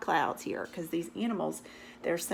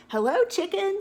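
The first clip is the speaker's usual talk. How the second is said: much higher, louder duck call; cheery duck greeting; 11 semitones higher, 8 dB louder than usual. Acted. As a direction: sing out hello up high.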